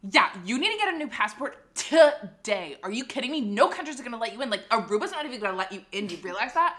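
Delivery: lightly voiced incredulous clerk; condescending clerk. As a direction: lecture like an impatient official.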